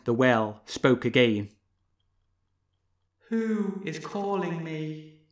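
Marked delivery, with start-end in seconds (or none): 3.3-5.2 high, soft, slow faraway voice; strange distant voice. Acted. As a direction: speak slowly from the depths. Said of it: "strange, slow-speaking voice".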